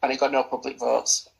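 ordinary delivery

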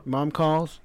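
ordinary delivery

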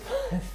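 high burst of laughter. Real, not acted. Cracking up at his own podcast, laughing. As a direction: crack up mid-sentence.

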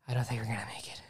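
a friend drunk at the stairs, mock grave. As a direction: whisper it like last words. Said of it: "he goes".

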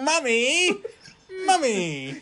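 high-pitched mock kid voice; put-on childish whine; child calling Mommy. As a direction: call out like a needy little child.

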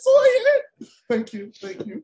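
shrieking with laughter through tears; crying with laughter. Real, not acted. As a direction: laugh till you cry. Laughing hard.

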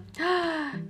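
sudden high intake of breath; sharp gasp. Acted. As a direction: gasp in realisation. Realising the phone mixup.

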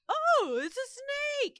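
high, loud mock alarm; mock gasp. Retelling the students' alarm, in a high mock gasp. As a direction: squeal it like a startled crowd.

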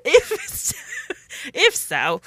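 put-on British accent slipping into Australian; accidentally Australian British. Host restarting her British line, accent gone Australian. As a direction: try British, land in Australian. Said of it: "That was super Australian".

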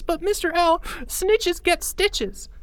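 put-on very high, drawn-out boy voice; high slow childlike plea; scared kid. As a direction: stretch out the warning slowly. Boy voice warns teacher.

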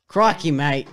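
loud, broad mock Australian; Australian accent. Podcast guest takes on the three-minute accent dare, Australian accent. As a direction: put on a comic Aussie accent. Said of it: "talking an accent of your choice".

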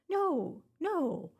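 ordinary talk, nothing marked

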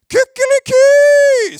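huge high crowing cock-a-doodle-doo, loud and stretched out; rooster crow. A narrator's reading. Preacher telling the story of the rooster crowing over the ruins, full-throated rooster crow. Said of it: "Han galte".

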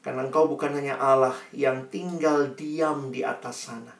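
measured prayer with reverent pauses; earnest prayer. Real, not acted. Preacher leads congregation in thanking God, earnest prayer. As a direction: address God humbly and earnestly.

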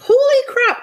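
shocked high exclamation; surprised. Real, not acted. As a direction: blurt it out, astonished. Surprised at the trivia answer.

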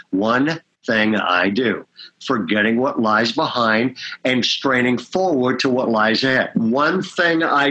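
solemn scripture quote at half pace; quoting Paul. Retold or imitated. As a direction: read Paul's words slowly, low. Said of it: "the Apostle Paul says".

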